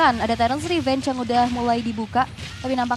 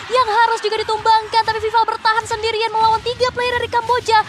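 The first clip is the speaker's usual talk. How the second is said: loud, high, fast play-by-play; excited commentary; 6 semitones higher, 5 dB louder than usual. Real, not acted. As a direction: call the fight at full pitch. Play-by-play of a team fight.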